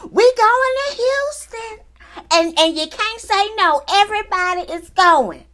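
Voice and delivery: high, slow, drawn-out announcement; big excited reveal. Retold or imitated. Hostess springs the Houston trip on the group, high and thrilled. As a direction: stretch it like a game-show reveal.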